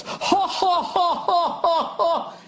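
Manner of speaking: high, stiff fake laugh; ha-ha in a stilted high voice; mock Al Gore laugh. Al Gore laughing at the debate, stiff and high. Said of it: "started laughing, just goes".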